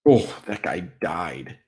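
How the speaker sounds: ordinary delivery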